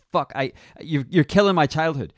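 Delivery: loud, high real outburst; outraged cry. Real, not acted. A co-host cries out that his childhood is ruined, loud. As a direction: cry out in mock-hurt disbelief. Outburst about stolen TV plot.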